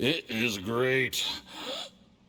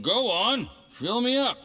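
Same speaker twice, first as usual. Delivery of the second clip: merry demand; 8 semitones higher than usual. Acted. Tipsy boss demands more wine.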